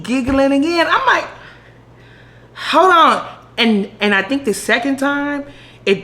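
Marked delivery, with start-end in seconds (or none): 2.5-3.3 higher, louder hold on; sudden realization. Retold or imitated. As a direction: stop short as it dawns.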